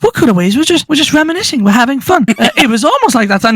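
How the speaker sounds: lightly acted, high-voiced protest; Pim protesting.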